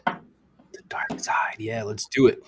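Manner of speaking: slow eerie whisper. Acted. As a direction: breathe it out ominously. Echoing ominous whisper.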